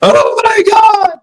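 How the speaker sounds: very high, drawn-out mock scream; hammed-up crying shriek, every word stretched; hysterical. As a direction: imitate a hysterical woman shrieking. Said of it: "she is just hysterical".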